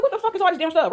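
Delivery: high-pitched incredulous exclamation; mock-outraged question; exasperated disbelief. Acted exasperation at petty charges.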